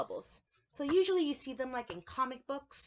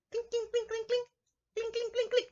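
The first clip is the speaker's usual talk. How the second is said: high, chanted clink clink; hammer clinks; 10 semitones higher than usual. Shown as a demonstration. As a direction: tap it out like a hammer. Imitating hammer sound.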